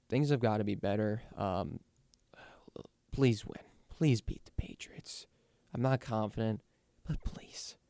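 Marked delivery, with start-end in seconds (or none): 3.1-5.3 slow whispered plea to the team; pleading whisper. Real, not acted. Fan pleading for a win. 7.1-7.8 slow whispered plea to the team; pleading whisper. Real, not acted. Fan pleading for a win.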